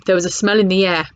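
plain reading voice

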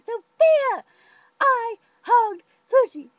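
very high, loud, slow gushing; thrilled announcement. Retold or imitated. Rachel telling Sophia about the hug, high loud thrilled gush. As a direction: burst with the news.

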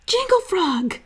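high, playful joke; kids joking. A narrator's reading. Brother and sister joking together, high and playful. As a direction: say it like two giggly kids. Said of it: "Stink and Judy said".

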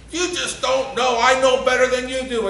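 mock a stubborn child insisting; child talking back to a parent; defiant child. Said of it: "And they just".

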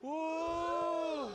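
shouted ooh. Acted. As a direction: lead the crowd in a big ooh. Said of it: "Everybody go".

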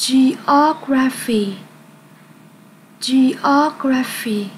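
Geography drawn out syllable by syllable; slow clear model word. Shown as a demonstration. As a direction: stretch out each syllable of Geography for the class. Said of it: "Các em hãy cùng đọc lại cho cô nhé". (đọc chậm từng âm tiết Geography)

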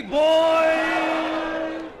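loud, high, long-held boing; cartoon spring boing. Zebedee springs into the story, loud high boing. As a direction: imitate a bouncing spring at full voice.

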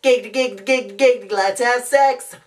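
high cartoon lech; Glenn Quagmire. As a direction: chatter eagerly as a sleazy cartoon womanizer. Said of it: "that was Quagmire".

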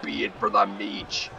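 deep voice. Real, not acted. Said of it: "I just got all deep and crazy there".

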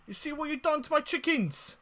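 high, loud furious accusation; outraged yell. Real, not acted. Gamer yelling at the witch who poisoned his chickens, high and loud.

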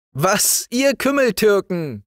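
put-on high-pitched boyish challenge; loud defiant call. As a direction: call out mockingly at the soldiers. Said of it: "rief er laut".

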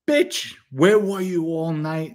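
a hint of a furious wife at dawn; imitating angry wife. Wife attacks him at the door.

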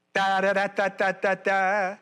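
even monotone run of "da"; rhythmic da-da syllables. Shown as a demonstration. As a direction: tap out steady syllables on one note. Beats out where emphasis falls.

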